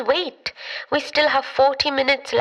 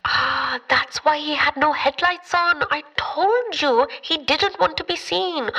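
ordinary talk

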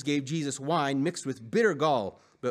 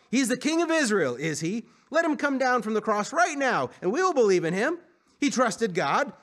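high-pitched, raised mockery; scoffing; 6 semitones higher, 4 dB louder than usual. A narrator's reading. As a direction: keep jeering, sarcastic and raised. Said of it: "they scoffed".

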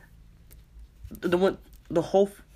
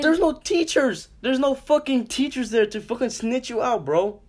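high, insistent argument; heated protest; 9 semitones higher, slower than usual. Real, not acted. Friend argues no teachers will snitch, high and heated. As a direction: raise the pitch sharply and argue it. Arguing with friend about pushing past.

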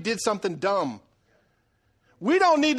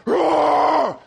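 loud, high roar of anger; mock roar; 6 semitones higher, 7 dB louder than usual. Husband showing how he looks when elevated, mock roar. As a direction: roar like someone blowing up in an argument. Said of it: "if I get a little elevated, I look like".

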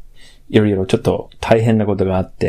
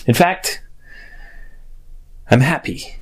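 ordinary talk, nothing marked

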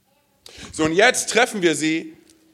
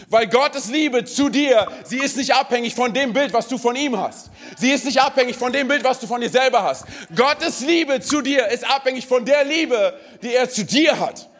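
fervent preaching; 6 semitones higher than usual. Acted. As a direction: preach it with full conviction. Preaching builds. (inbrünstig predigen)

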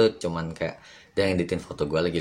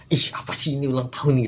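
higher-pitched mock protest; lightly acted inner voice, pitched up; one inner side; 7 semitones higher, 3 dB louder than usual. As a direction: play the half of you that objects. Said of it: "kebelah dua gitu".

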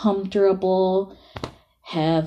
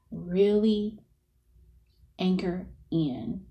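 softer, half-whispered breathing cues; guided meditation; 9 semitones higher, 12 dB quieter than usual. Acted.

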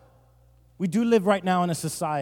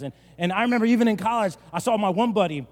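usual speaking voice

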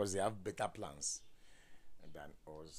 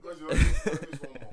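laughing tease; 9 semitones higher, 17 dB louder than usual. Real, not acted. Teasing wife, laughing.

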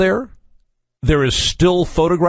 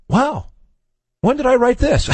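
higher, amazed at his own writing; mock surprise; 10 semitones higher than usual. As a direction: act out rereading in disbelief. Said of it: "you look at it and go".

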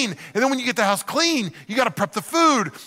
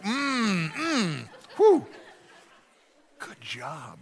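forced mmm; 6 dB quieter, much slower than usual. Retold or imitated.

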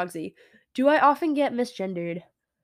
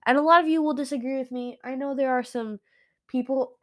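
ordinary talk

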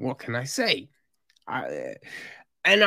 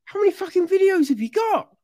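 incredulous rant; much faster than usual. Retold or imitated. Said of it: "I'm looking at him like".

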